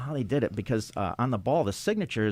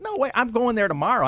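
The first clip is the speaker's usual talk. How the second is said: high, louder eager "no way"; retold eager reply; 11 semitones higher, 5 dB louder than usual. Refusing to wait for friend, high eager reply. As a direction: sound impatient to go. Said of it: "And I said".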